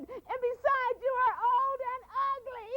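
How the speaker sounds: very high, taunting sneer; spoiled girl insulting the old woman as she runs; mocking insult. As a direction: jeer at her over your shoulder. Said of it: "she turned around and she said".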